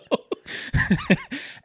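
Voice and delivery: big, high-pitched laugh. Real, not acted. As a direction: burst out laughing.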